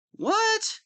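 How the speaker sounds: a sudden high squeak of outrage; indignant what. Acted. Villain reacts to the hero doubting him, high outraged squeak. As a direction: yelp it, offended.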